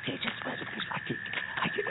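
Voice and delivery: hushed whisper. Acted. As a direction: whisper to hold her back. Sneaking near guard dogs.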